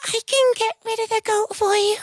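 a tiny ant calling from the rose bush. Acted. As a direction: miniature squeak. Ant offers to help.

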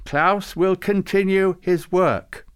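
slow, high, loud solemn proclamation; solemn intoning. A narrator's reading. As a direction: intone it like an official decree. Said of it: "intoned solemnly".

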